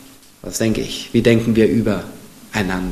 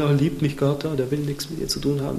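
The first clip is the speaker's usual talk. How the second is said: much softer, sly tempting thoughts; devil's whisper; 12 dB quieter than usual. Pretend. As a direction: murmur doubts like the devil in your ear. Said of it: "ist vom Teufel".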